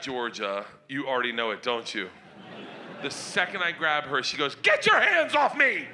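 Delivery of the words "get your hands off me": put-on high, loud girl's yell; mock yell. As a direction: shriek it out at dad. Daughter yells at dad.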